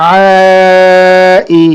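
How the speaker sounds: level, sustained first vowel in "আই"; stretched reading. Shown as a demonstration. Teacher reading through for students, "আ" drawn out. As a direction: hold "আ" long in "আই", then short "ই". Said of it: "টানা পড়ে চলে যাবো".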